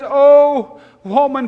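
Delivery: "Oh, woman" cried high and loud; emphatic exclamation. Retold or imitated. Preacher repeating Jesus's "Oh, woman" for emphasis, emphatic. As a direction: swell loudly on "Oh", then "woman". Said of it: "for emphasis".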